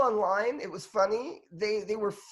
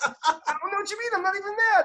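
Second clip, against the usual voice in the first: much higher, laughing through a fake-innocent denial; mock innocent protest; 11 semitones higher, faster than usual. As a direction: play dumb with a laugh, pitched up. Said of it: "And you're like".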